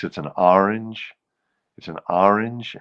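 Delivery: orange in a Bronx accent. Shown as a demonstration. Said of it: "In the Bronx".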